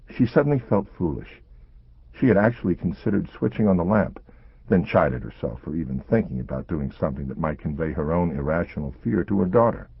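ordinary delivery, nothing marked

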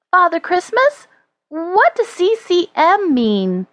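put-on high, questioning boy's voice; high-pitched young Neil, inquiring; curious child. A narrator's reading. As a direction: ask wonderingly in a high child voice. Child Neil questions Father Christmas.

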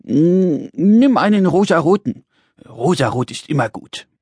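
lightly voiced little man, musing; hesitate, then advise as if pondering; thoughtful. Little man picks a colour for Brix, thoughtful. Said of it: "nachdenklich fügte er hinzu".

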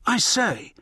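startled high exclamation; shocked. Acted. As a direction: caught off guard, voice jumping up.

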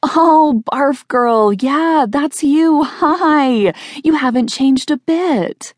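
put-on high, squealy old-classmate greeting; gushing recognition. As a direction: gush in a high put-on voice, drawing out the end. Reunion guests recognise her with delight.

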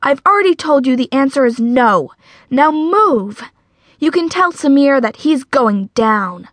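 forceful, fearless declaration; bold. A narrator's reading. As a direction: order them off boldly. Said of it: "Patty said boldly".

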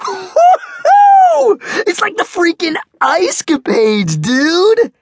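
very high, loud whoop then rave; whooping cheer. Acted. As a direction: throw your head back and whoop. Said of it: "He threw back his head".

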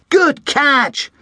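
gasped praise. A narrator's reading. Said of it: "gasped Tom".

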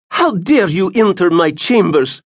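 high, haughty royal rebuke; imperious Tsarina. A narrator's reading. Tsarina rebuking Doc for entering her chambers. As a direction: scold like an empress used to obedience. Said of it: "an admonition from someone who was used to having her every word obeyed".

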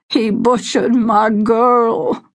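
sobbed. A narrator's reading. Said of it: "Hunter Davidson sobbed".

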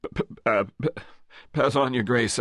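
stuttering guard, thrown off balance; flustered stammer. A narrator's reading. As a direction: trip over the words in a fluster. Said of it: "petty authority punctured".

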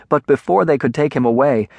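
ordinary delivery, nothing marked

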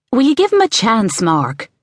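mother's high call to her son. A narrator's reading. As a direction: call it high, dropping on the name. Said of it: "Ali had called".

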